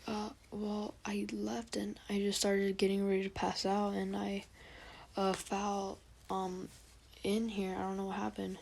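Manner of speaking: put-on soft, halting little boy voice; put-on little kid voice, hushed; little kid Naruto. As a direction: explain it quietly like a small, unsure child. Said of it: "trying to make a little kid voice".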